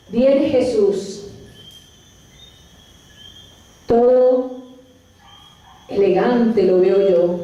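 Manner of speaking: low, slow reverent narration; guided visualization. Acted. Preacher guiding listeners with closed eyes through the scene, reverent. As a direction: paint the scene slowly, with long pauses.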